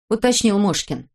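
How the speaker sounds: ordinary delivery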